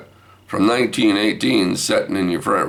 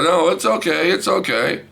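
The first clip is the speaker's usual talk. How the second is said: flustered consoling; 12 semitones higher than usual. Retold or imitated. Consoling crying granddaughter, worked up.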